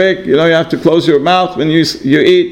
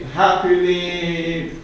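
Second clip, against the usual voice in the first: usual speaking voice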